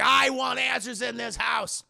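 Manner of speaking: loud, slightly hammed-up patriarch; angry demand. Dad confronts mom demanding answers, angry demand. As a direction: insist forcefully as head of house.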